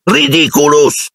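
high, drawn-out incantation; shrieked spell. A narrator's reading. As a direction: call the spell out high and long. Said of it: "strillò Dean". (incantesimo strillato)

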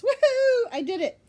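loud, high celebratory whoop; excited. Real, not acted. Podcaster cheering herself for nailing the tongue twister, excited. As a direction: whoop with triumph. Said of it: "I'm so excited I said it right".

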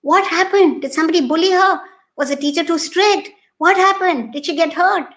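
anxious rapid questions; horrified mother. Retold or imitated. Mother fearing her child was hurt, mildly alarmed. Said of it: "her mother was horrified".

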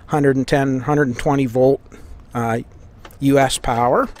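usual speaking voice

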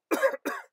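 short unvoiced cough; cough, then apologise; breathy cough. A narrator's reading. The Lorax apologizes for his cough, short cough. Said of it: "please pardon my cough".

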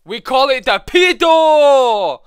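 high, loud, slow shout; big shouted punchline. Pretend. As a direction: belt out the punchline. Punchline shouted after build-up.

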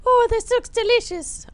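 very high, squeaky delight; delighted falsetto. Acted. Player drooling over Candy World, delighted falsetto.

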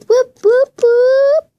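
high, loud whooping cheer; cheering whoops. Pretend. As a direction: whoop it up like a crowd. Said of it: "That was good excitement".

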